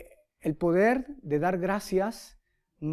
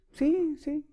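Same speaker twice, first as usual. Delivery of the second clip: much higher, quick eager "sí, sí"; eager yes; 9 semitones higher than usual. Retold or imitated. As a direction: jump at the offer like a kid. Eager reply about the mirror.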